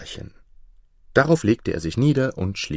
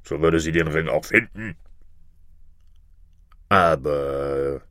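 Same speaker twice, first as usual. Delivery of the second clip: low monster bird voice; deep, boastful creature; know-all griffin; 5 semitones lower than usual. Acted. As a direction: pronounce it from down deep, with scorn. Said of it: "sagte der Vogel Greif".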